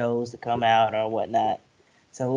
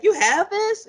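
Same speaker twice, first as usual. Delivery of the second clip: much higher, squeaky with laughter; laugh-crying quote; 5 dB louder than usual. Retold or imitated. Friend retelling a pirate scene, laughing to tears. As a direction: barely get it out for laughing. Quoting Blackbeard, laughing hard.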